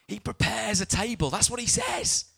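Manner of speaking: high, loud burst of preaching. Real, not acted. Preacher driving the verse home, high loud exclamation. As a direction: cry it out well above normal pitch.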